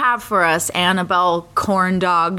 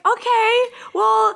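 high, tentative mock politeness; awkward retreat; 11 semitones higher, slower than usual. Retelling their reply to the ranting customer, awkwardly high. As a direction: pitch it right up, backing out of trouble.

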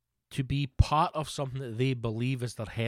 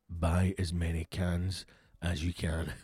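low, slow, deadpan dad voice; a dad dead serious about his soda; dad on the phone urging him to stock up, low and slow; dad's urgent order; 7 semitones lower, slower than usual. Retold or imitated.

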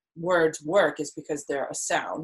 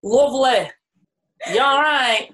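high, loud northern English accent; northern Barnsley accent; 8 semitones higher, 6 dB louder than usual. Shown as a demonstration. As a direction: belt it out in broad Barnsley. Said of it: "your northern Barnsley accent".

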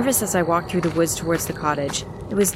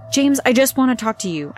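quick urgent blurt; 5 semitones higher than usual. A narrator's reading. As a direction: rush the words out nervously. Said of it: "I blurted out quickly".

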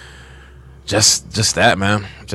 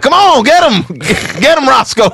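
excited mock cheering; 13 semitones higher, 4 dB louder than usual. Imagined cockfight cheering, high loud.

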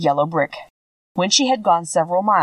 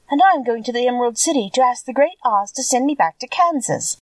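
higher, lightly voiced eager girl; young girl voice; 6 semitones higher than usual. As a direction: speak up as a bright little girl.

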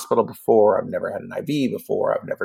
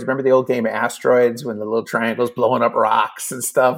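ordinary talk, nothing marked

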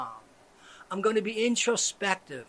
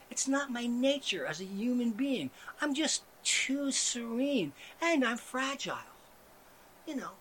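ordinary talk

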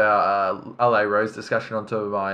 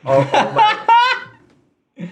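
very high, slow, drawn-out exclamation; excited; 5 dB louder, much slower than usual. Real, not acted. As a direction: stretch it out in a high squeal of delight. Excited about rumour.

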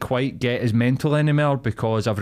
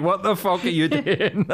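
laughing quote; 8 semitones higher than usual. Retold or imitated. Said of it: "everybody was just like".

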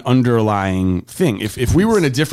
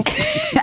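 high wheezing laugh; 4 dB quieter than usual. Real, not acted. Laughing at creepy voice.

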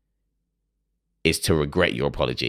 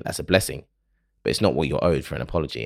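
normal talking voice

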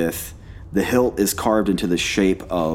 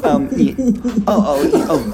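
flustered laugh through the words; giggle, then recover in character; 14 semitones higher, 5 dB louder than usual. Acted. Caught off guard by a joke.

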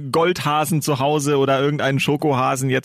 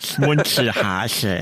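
put-on lisping child; 5 semitones lower, much slower than usual. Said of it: "dann lispelt dieses Kind immer so".

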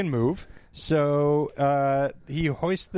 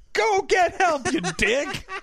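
mock yell; 11 semitones higher, slower than usual. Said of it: "he's like".

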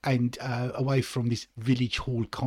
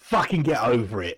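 blunt dismissal; 3 dB louder than usual. Acted. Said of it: "first attitude towards mental health".

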